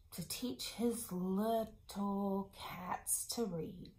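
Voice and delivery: slow conspiratorial whisper. Shown as a demonstration. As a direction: whisper it like a shared secret to the kids. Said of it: "teacher whispers to children".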